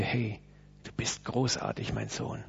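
soft, low, almost whispered praise; a father murmuring pride to his grown son; tender father's praise. Pretend. Father beside his son, speaking softly and low.

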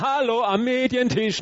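high call across the room; hey, over here at the media table. Pretend. Preacher calling for media table helpers, high call.

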